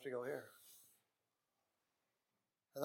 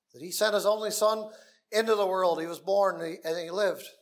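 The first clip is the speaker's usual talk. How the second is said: raised, louder preaching voice; fiery preaching; 3 semitones higher, 6 dB louder than usual. Acted. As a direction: preach it out with full force. Preaching God's love.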